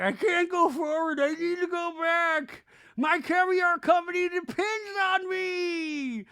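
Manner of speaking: loud, very high wail; hammed-up hysterical imitation; mock panicked screaming. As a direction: shriek in overdone panic, stretching the last words. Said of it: "they're just all screaming".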